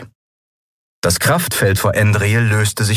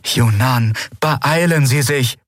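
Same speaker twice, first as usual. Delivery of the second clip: higher, urgent inner plea; pleading thought; 6 semitones higher, slower than usual. A narrator's reading. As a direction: beg silently for help. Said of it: "sie flehte im Gedanken".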